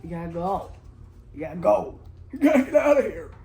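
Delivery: high, loud panicked shouting; frantic yelling. Acted. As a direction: urge them out in panic. Frantic urging in scared story.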